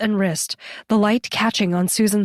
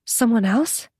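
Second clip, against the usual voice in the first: ordinary delivery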